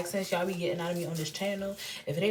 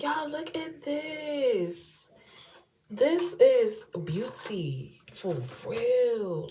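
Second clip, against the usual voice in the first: savoring; 4 semitones higher, much slower than usual. Real, not acted. Showing lashes, slowed.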